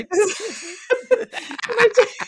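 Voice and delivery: high, loud laugh breaking the words; can barely speak for laughing. Real, not acted. Cracking up mid-story.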